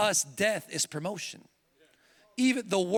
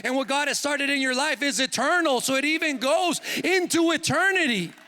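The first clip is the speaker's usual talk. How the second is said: loud and high, driving preaching climax; full-force preaching; 4 semitones higher, 5 dB louder than usual. Acted. Preacher rousing the congregation at the close, loud and high. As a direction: preach it home with full fire.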